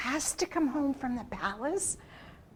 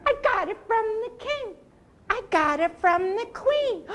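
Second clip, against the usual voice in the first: high, fast, laughing monkey chatter; monkey talk; 9 semitones higher, 5 dB louder than usual. Shown as a demonstration. Storyteller demonstrating monkey talk, high and giggly. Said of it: "Let me hear some monkey talk".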